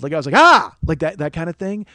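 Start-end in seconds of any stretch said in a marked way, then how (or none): 0.3-0.7 sudden loud, high yelp of pain; imitated yell. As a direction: re-enact a short, sharp yell. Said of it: "I yelled".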